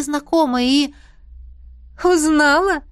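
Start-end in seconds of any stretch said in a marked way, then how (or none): 2.0-2.8 giggling. A narrator's reading. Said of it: "хихикнула леди".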